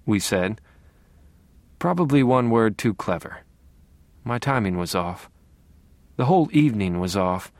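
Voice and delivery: usual speaking voice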